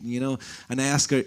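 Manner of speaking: ordinary talk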